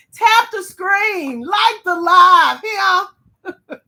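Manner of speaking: high, loud, drawn-out plea to the chat; excited call-out. Real, not acted. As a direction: shout it out to the viewers. Calling out to chat viewers.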